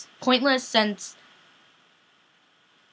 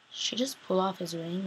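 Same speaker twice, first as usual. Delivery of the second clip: much softer, lower repeat of the question; stunned murmur; 3 semitones lower, 12 dB quieter than usual. Retold or imitated. Viewer repeating it to herself in stunned quiet. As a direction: repeat it to yourself, dropping away in shock. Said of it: "and I'm like".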